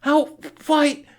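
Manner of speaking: very high, loud, clipped questions; shrill demand. A narrator's reading. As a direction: fling them out in outrage. Screeching at Hiccup.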